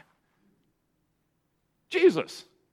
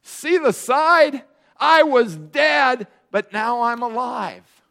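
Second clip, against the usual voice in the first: higher and louder, slowing at I was dead; insistent Jesus; 5 semitones higher, 4 dB louder than usual. Retold or imitated. Jesus showing his wounds to doubters, insistently. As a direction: convince them it's really him. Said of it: "He says".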